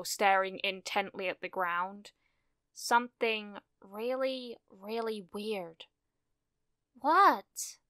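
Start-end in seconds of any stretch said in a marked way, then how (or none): 2.7-5.9 soft, drawn-out puzzlement; hushed unease. A narrator's reading. Jiro listens to strange noise, uneasy. 7.0-7.8 high-pitched baffled question that falls; bewildered. A narrator's reading.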